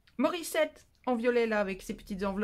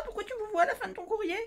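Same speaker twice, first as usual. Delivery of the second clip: quick and much higher mock outrage; mock-offended squeak; mock indignant; 10 semitones higher, faster than usual. Real, not acted. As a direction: play hurt, rushing the question up high.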